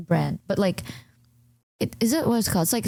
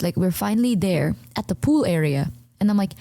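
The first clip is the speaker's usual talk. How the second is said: ordinary delivery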